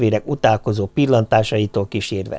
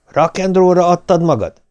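cold but raised-pitch challenge; cold question; 7 semitones higher, 4 dB louder than usual. A narrator's reading. Husband questions his wife on the phone, coldly. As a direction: question her coldly, voice lifted. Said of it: "kérdeztem hidegen".

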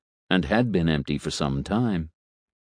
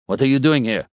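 snapping; 5 semitones higher, 3 dB louder than usual. Acted. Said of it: "Vlad snapped".

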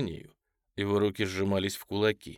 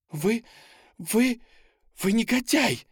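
высокий громкий женский вскрик; задохнуться от негодования и закричать высоко; возмущённый крик; 12 semitones higher, 6 dB louder than usual. A narrator's reading. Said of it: "закричала она". (кричать в ужасе от наглости)

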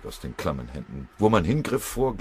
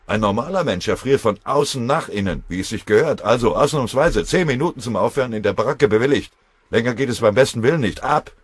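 loud, raised-pitch gruff orders; blustering; 6 semitones higher, 9 dB louder than usual. A narrator's reading. Foreman grudgingly granting a short warm-up, loud blustering. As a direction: boom it out at a subordinate. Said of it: "polterte Schöppel".

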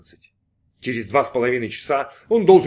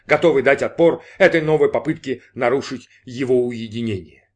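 plain reading voice

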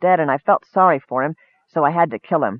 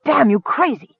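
growled; 9 semitones higher than usual. Acted. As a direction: snarl it at the reckless Kender. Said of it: "he growled".

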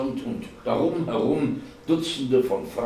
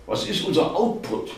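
higher, pointed question; incredulous question; 7 semitones higher, 3 dB louder than usual. A narrator's reading. Martin to Ad, unseen at his desk, incredulous. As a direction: fire the question across the room. Exasperated question to colleague across room.

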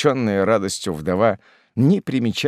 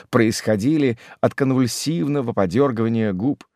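usual speaking voice

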